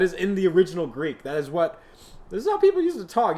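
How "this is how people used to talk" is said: excited fast, higher outburst. Acted. Sudden excited insistence.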